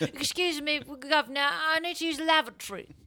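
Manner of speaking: put-on high prim British accent; a fop asking for the lavatory in a posh British accent. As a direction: play a fussy old British gentleman. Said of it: "born a fifty-year-old British man".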